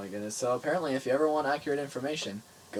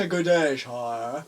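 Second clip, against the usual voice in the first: slow, drawn-out mock British greeting, louder; asshole British voice; 5 dB louder, much slower than usual. Friend demonstrates his voice again, hammed-up British accent. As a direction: ham up an obnoxious British accent. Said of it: "asshole British voice".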